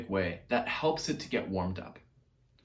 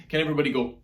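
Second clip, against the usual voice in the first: usual speaking voice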